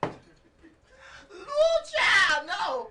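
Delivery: high shocked yelp of disgust; shocked cry. Real, not acted. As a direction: cry out in disbelief. Reacting in disgust, crying shout.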